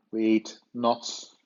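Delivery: usual speaking voice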